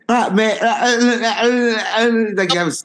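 very high babbling impression; Russell Brand. Shown as a demonstration. Fumbling through a Russell Brand impression, voice way up. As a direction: imitate a high-pitched English comic. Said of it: "Russell Brand".

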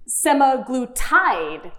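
"semaglutide" very slow, as SEMA-gloo-TIDE; errant pronunciation. Shown as a demonstration. Host tries an odd option, "semaglutide" drawn out to TIDE. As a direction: draw out "semaglutide", landing on a long TIDE. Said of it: "is there an errant pronunciation".

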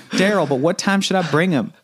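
high, put-on Southern woman's voice; Southern driver. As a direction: ask as the driver character. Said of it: "as the driver, I'm like".

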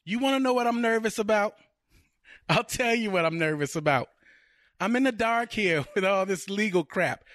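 high-pitched, worked-up fiancée; exasperated movie girlfriend. Acted. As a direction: play a fed-up girlfriend venting her nerves. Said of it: "I'm gonna go with Marissa Tomei's character".

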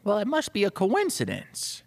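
high, dismissive assistant; assistant's voice. Acted. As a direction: brush off the boss's worry. Assistant answers the worried chief.

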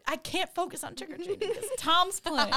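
put-on high, pleading little-boy voice; whiny kid impression. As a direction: imitate an upset child making excuses, half laughing. Voices upset nephew pleading.

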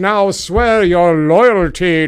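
booming, slow royal decree; King Donald. Pretend. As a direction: proclaim it like a pompous tyrant king. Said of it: "I am King Donald".

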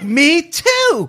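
high, loud squeal of delight; imitated excitement. Waitress finding a fellow Michigander, imitated loud excited squeal. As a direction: shout it up high as the thrilled waitress. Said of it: "She was like".

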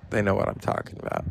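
quick, breathy whispered aside; knowing whisper. Real, not acted. To viewers who were there, a quick knowing whisper. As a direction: let it slip out as a hushed in-joke.

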